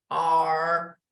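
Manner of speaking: "R" low and resonant from the throat and chest; English R. Shown as a demonstration. Teacher demonstrating the English R. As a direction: let "R" vibrate in your chest. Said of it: "You feel it here".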